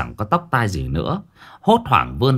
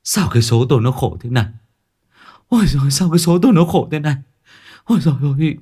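fast, higher blubbering wail; whimpering lament; 5 semitones higher, much faster than usual. A narrator's reading. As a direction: whine about his bad luck. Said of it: "mếu máo". (mếu máo than thân)

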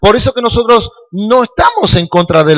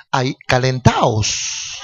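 slow hushed quote; 5 semitones lower, 8 dB quieter, much slower than usual. Retold or imitated. Preacher slowly quotes Jesus paraphrase.

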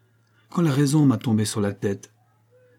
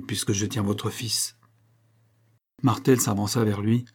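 ordinary delivery

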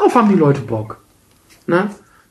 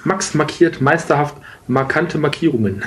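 slow tongue twister. Shown as a demonstration. Tongue twister slowly, laughing.

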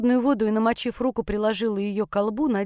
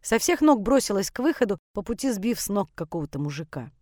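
usual speaking voice

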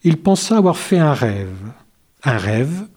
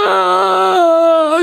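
very high, loud scream of fright; frightened howl; 4 dB louder than usual. A narrator's reading. Wolf sees himself in the mirror, high scream. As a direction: shriek at his own reflection. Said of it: "hurla le loup de peur".

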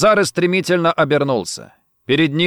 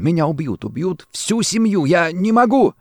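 rising, high-pitched plea; desperate fear; 5 semitones higher than usual. A narrator's reading. Rossetti fears for his family, desperately afraid. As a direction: build into panic, higher with each phrase. Terrified banker pleads at gunpoint.

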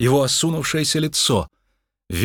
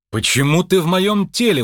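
high, outraged question; indignant; 8 semitones higher, 4 dB louder than usual. Acted. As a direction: demand it in raised-pitch indignation. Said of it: "возмутился Джо". (возмущённо)

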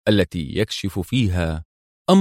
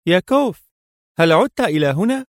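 hesitant call; 10 semitones higher, 4 dB louder than usual. A narrator's reading. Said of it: "فطرقت الباب في تردد وقالت".

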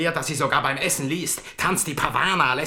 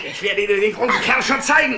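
mocking threat; 7 semitones higher, 4 dB louder than usual. Brother seizes sister.

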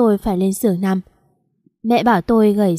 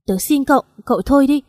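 begging, rising to a raised cry; pleading shout; 5 semitones higher than usual. A narrator's reading. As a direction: cry out to make him stop. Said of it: "tôi lại gào lên". (van nài rồi gào lên)

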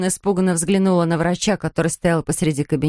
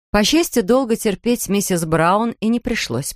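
ordinary delivery, nothing marked

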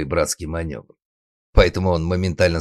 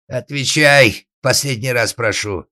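loud, high shouted demand; final warning; 7 semitones higher, 9 dB louder than usual. Acted. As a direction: roar a last warning, dragging out the first word. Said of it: "предупредил отец".

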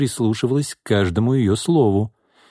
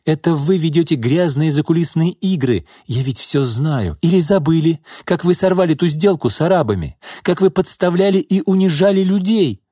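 heated, higher-pitched denunciation; accusing tirade; 8 semitones higher, 3 dB louder than usual. A narrator's reading. Secretary exposes boss's dirty games, accusing. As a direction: build the charges to a high peak.

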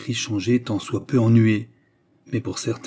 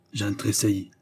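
ordinary delivery, nothing marked

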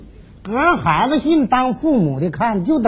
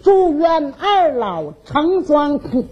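slow, higher, drawn-out declaiming; letter recitation; 6 semitones higher, slower than usual. Acted. Father reading the children's wish for the old pair, slow recitation. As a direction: stretch it like a recited blessing.

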